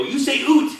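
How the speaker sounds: "oot" high and loud, with an oo vowel; mock accusing "oot". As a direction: accuse them loudly, exaggerating "oot". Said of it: "So they go".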